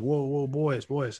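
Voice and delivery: light imitation of a Southern North Carolina accent; Alex Gibbs. Line coach stopping his players, imitated North Carolina accent. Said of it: "Southern North Carolina accent".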